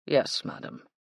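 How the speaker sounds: understated dry reply; a trifle dry. A narrator's reading. Butler humouring madam's independence, a trifle dry. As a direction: answer a touch drily. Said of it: "sounded a trifle dry".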